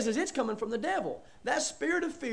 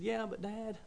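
put-on soft, mildly pleading kid; small, hushed retold plea; imitated child's protest; 9 dB quieter than usual. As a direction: voice a child quietly objecting. Acts out child pleading with dad.

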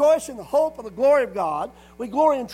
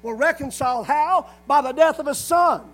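raised, loud scripture proclamation; preaching; 3 semitones higher, 6 dB louder than usual. Acted.

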